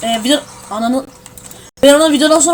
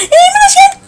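very high, loud, squeaky mock greeting; mocking imitation; 7 dB louder than usual. Mimicking the cousin's dumb greeting, squeaky and high. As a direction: ape the annoying kid's hello. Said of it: "Bir de mal mal".

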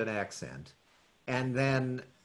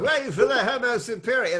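high, loud put-on posh Cockney; much higher and louder English impression; 12 semitones higher, 7 dB louder than usual. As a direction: quote it as a grand, high-pitched London rock star. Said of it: "throw in quotes from [name] songs".

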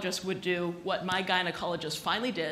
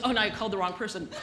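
rushed nurse; much faster than usual. Retold or imitated. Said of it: "She goes".

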